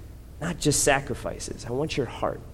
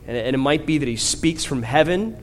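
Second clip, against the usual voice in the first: usual speaking voice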